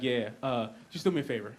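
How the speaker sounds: soft, grave request; dead serious. Retold or imitated. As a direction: plead quietly and firmly. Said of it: "dead serious on the phone".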